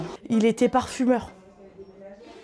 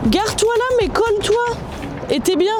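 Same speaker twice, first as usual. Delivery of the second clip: raised, higher call to friends; 8 semitones higher than usual. Real, not acted. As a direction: call over to them from across the room. Calling out to others while filming.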